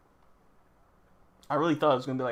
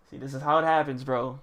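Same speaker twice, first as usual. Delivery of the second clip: exasperated; 8 dB louder than usual. Real, not acted. Exasperated at doomed situation.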